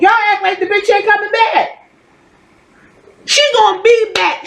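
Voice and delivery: high-pitched exasperated insistence; real exasperation, voice riding high. Real, not acted. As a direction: pitch it way up, fed up with them. Exasperated at fans' complaints.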